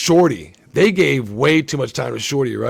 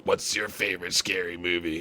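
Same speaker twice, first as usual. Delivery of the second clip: low, hushed, menacing; creepy killer phone voice; 11 semitones lower, 10 dB quieter than usual. Retold or imitated. Retelling the movie, the killer's creepy low phone question. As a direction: lower and softer, like the masked caller on the line.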